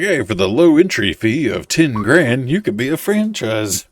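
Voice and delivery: lightly put-on salesman patter; sales pitch. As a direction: pitch it like a hard-sell promoter. Acts out franchise sales pitch.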